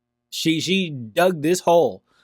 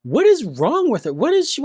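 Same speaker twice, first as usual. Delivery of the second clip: incredulous; 8 semitones higher than usual. Real, not acted. Reacting to defiant woman in clip.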